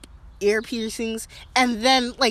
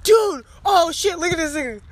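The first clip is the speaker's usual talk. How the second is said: excited; 8 semitones higher, 5 dB louder than usual. Real, not acted. Spotting a squirrel doing flips.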